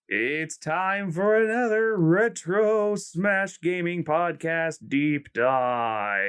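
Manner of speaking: slow booming intro announcer; fighting game announcer. Acted. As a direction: stretch out the show title.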